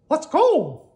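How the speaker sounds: high, loud cartoon call; rally the others in a high voice; eager Mickey. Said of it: "said Mickey".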